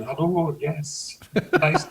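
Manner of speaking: a hint of a put-on posh Scottish accent; lightly mimicked mum moving to the posher street, putting on a posh voice. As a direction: mum trying to sound posh, lightly done. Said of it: "my mother's accent changed overnight".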